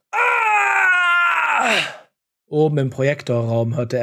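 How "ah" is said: high, loud cry of pain; pained scream. Acted. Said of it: "schrie vor Schmerz".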